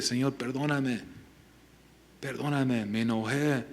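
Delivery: soft, humble mock confession; hushed repentance. Cain could have repented to the Lord, hushed plea. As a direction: plead softly for forgiveness, as if praying.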